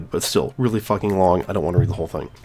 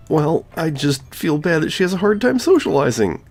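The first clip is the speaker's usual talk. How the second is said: much higher, teasing club president voice; Monika voice; 5 semitones higher than usual. Acted. Gamer voicing Monika teasing the player about Yuri. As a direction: play her as a knowing, teasing schoolgirl.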